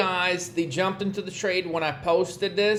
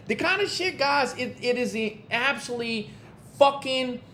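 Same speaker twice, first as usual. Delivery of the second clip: ordinary talk, nothing marked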